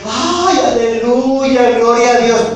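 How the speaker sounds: hammed-up high, slow wailing aleluya; high drawn-out mocking cry of praise; mock ecstatic praise. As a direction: parody a worshipper overcome with emotion. Mocks ecstatic weeping worshippers.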